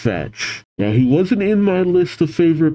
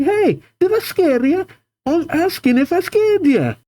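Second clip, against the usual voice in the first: put-on very high, pushy Scottish girl; aggressively Scottish. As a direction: demand an answer in a mock Scottish accent. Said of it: "She is aggressive, a little aggressive".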